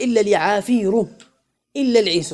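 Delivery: "اليعافير" and "العيس" each ending in a sounded -u; damma endings. Shown as a demonstration. Teacher showing how the poet said it, -u endings on "اليعافير" and "العيس". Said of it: "بالر-، بالضم".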